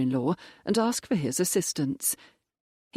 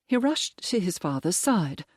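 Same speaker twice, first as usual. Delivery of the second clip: ordinary delivery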